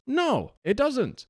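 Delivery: slow, high, pointed denial; emphatic no. Real, not acted. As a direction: drag it out, incredulous. Scoffing at needless data collection.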